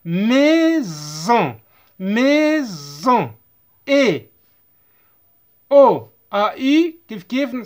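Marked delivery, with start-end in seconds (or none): 0.0-3.3 "Maison" with a long buzzing Z; teacher's syllable-by-syllable "Maison"; S pronounced Z. Shown as a demonstration. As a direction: split "Maison" as mai-zon, drawing out each part. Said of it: "S entre deux voyelles se prononce Z".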